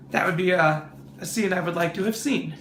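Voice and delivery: ordinary talk, nothing marked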